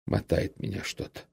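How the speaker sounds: soft, voiceless mumble; barely audible, faint and dizzy; weak faint murmur. A narrator's reading. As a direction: mumble it feebly to yourself. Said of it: "слабым, неслышным, самому себе голосом".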